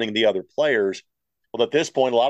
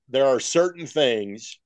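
normal talking voice

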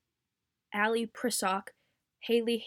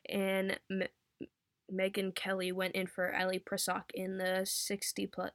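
ordinary delivery

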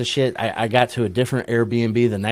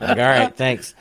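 high-pitched laughing reply; 12 semitones higher, 4 dB louder, slower than usual. Retold or imitated. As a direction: say it while cracking up.

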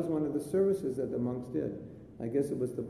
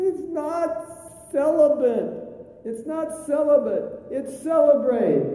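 loud, very high hammed-up wail; hysterical crying; 12 semitones higher, 8 dB louder, slower than usual. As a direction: wail it out like a devastated monk, stretching the last word. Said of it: "He was hysterically crying".

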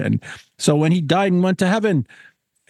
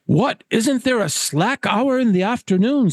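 higher-pitched, incredulous gentleman; protesting Caswell; 7 semitones higher than usual. Acted. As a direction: question it like an affronted angler.

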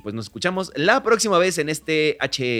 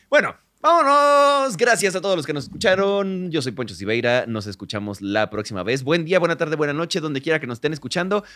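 announcer outro; 6 semitones higher, 7 dB louder than usual. Acted. Podcast sign-off.

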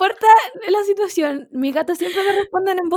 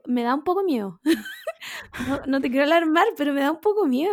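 ordinary delivery

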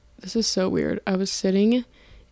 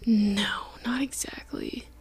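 whispered aside; 8 dB quieter than usual. Real, not acted.

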